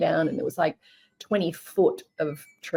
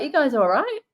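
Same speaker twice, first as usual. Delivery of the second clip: higher-pitched check-in, lightly voiced country bloke; Aussie country guy; 8 semitones higher than usual. Country guy checks on strangers in their car, lightly imitated. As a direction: lightly imitate a friendly Aussie local calling in. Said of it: "typical Aussie country guy".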